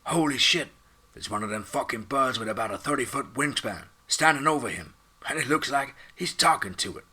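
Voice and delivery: loud, high burst of disbelief; stunned exclamation. Acted. Drone operator reporting the giant bird in disbelief. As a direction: blurt it out in shock. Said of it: "I S R announced".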